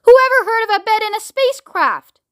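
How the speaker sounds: high-pitched, incredulous outburst; barking. A narrator's reading. President doubting Shanks, incredulous bark. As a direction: bark in sheer disbelief. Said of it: "barked the President".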